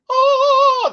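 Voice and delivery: high, loud drawn-out "oh" of wonder; imitated movie sound. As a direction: mimic a film's swooning sound effect. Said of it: "in the movies where it's like".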